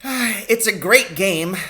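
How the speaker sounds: slow, wistful sigh in the voice; a fond, regretful old poacher; sighing a little. A narrator's reading. Doctor sighing over his old poaching days. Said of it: "sighing a little".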